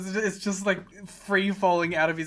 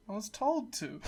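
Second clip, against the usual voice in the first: softer, defeated mock confession; 3 semitones higher, 8 dB quieter than usual. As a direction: admit it like a beaten man. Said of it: "The defeat in Alex's voice".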